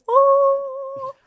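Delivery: loud, very high sustained "oh"; high-pitched toning. Retold or imitated. Imitating the man toning down the phone, loud high drone. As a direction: imitate a weird high healing tone, held long. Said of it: "dead high pitch".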